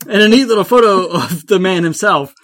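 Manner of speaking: amused reread, laughing; laughing re-read. Real, not acted. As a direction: crack up while rereading it.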